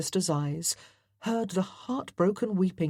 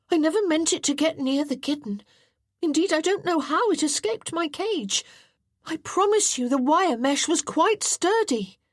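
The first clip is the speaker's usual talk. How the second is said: put-on higher boy's voice, quick and pleading; defensive boy John; 12 semitones higher, 4 dB louder than usual. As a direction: protest your innocence in a rush.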